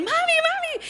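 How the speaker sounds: put-on very high child calling for mom; little son calling. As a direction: squeal mami like a small boy. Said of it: "empieza a decir".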